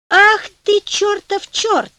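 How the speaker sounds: loud, high, drawn-out indignant cry; exclaiming. A narrator's reading. Youth rounding on the devil who cheated him, exclaiming. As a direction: cry it out slowly, landing on each word. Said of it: "воскликнул Франческо".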